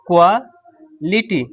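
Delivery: Quality drawn out very slowly, syllable by syllable; teacher slowly spells out quality; slow demo. Shown as a demonstration. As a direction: stretch quality slowly so students can follow each sound.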